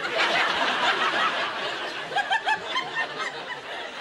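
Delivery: breathy high cackle at his own joke; high unvoiced cackle. Real, not acted. Warm-up man cracking up at his own gag, high cackle.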